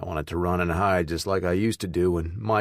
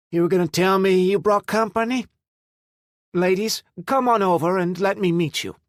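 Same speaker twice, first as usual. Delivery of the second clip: high, louder welcoming call; bright welcome call; 12 semitones higher, 5 dB louder than usual. A narrator's reading. Mother spotting the guests and calling them over, loud and welcoming.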